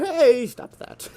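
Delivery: high, slow, hammed-up character voice; mock game character. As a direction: parody the NPC's line, drawn out. Parodying the game character's line.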